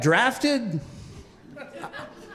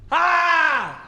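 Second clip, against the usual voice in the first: high, drawn-out mock scream; 9 semitones higher than usual. Kid picked up by the angry coach, high mock scream. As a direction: scream as if being carried off, acted. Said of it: "screaming at the top of my lungs".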